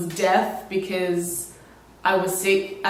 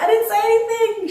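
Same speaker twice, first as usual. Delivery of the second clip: much higher, louder protest in Italian; panicked denial; 4 dB louder than usual. Retold or imitated. Vlogger retells protesting her innocence to the stranger, high and loud. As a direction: squeak out your innocence. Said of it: "I was like".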